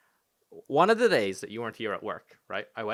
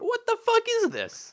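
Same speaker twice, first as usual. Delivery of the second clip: high-pitched squawk of disbelief; mock outrage. Real, not acted. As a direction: demand it in a shooting-up voice.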